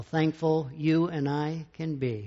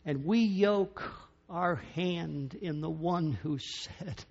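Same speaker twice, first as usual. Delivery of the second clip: ordinary talk, nothing marked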